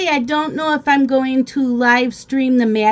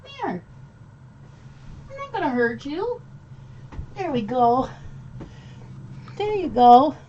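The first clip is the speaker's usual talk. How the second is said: high, much softer sweet-talk; soft coaxing; 5 semitones higher, 6 dB quieter than usual. Real, not acted. Streamer reassures the scared dog, soft high coaxing. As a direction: win the dog over gently.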